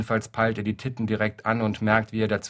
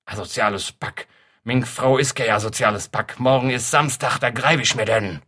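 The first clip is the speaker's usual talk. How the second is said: mildly raised, grumbling Kölsch anger; rising fury, a touch louder; angry; 4 dB louder than usual. Acted. Husband fuming over the insult, mildly angry. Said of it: "kocht der Zorn".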